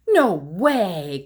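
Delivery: flatly refuse, loud; protesting cry. Acted. Scene: Froggy refuses the play date with his mother, loud cry. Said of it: "cried Froggy".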